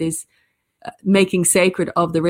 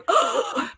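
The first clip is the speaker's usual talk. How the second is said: sharp high gasp of shock; 7 semitones higher than usual. Retold or imitated. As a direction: gasp in horror. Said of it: "the audience was sitting there going".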